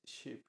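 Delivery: "ship" said softly in English, sh as in English; English word ship. Shown as a demonstration. Narrator showing listeners the English sh, soft English "ship". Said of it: "como S H en inglés".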